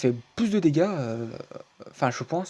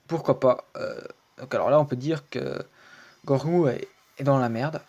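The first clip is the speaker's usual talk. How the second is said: ordinary talk, nothing marked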